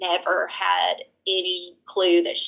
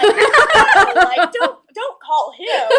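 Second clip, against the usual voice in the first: high, loud mock wail with laughter; retold sobbing; 12 semitones higher, 9 dB louder, much slower than usual. Sobbing wife begging agency not to call husband, mock wail. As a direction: plead in a hammed-up sobbing squeal. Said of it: "so I'm just sobbing".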